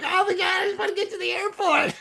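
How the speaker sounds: squeaky high voice, laughing through it; laughing high-pitched plea; high-pitched panic. Retold or imitated. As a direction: squeal it out like a desperate passenger. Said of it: "I'm like".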